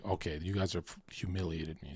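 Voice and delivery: quiet bitter aside; muttered retort. Retold or imitated. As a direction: mumble it under your breath, hurt. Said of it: "I was like".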